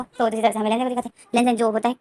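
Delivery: usual speaking voice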